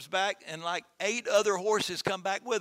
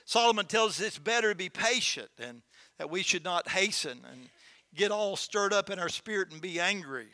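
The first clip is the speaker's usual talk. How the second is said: usual speaking voice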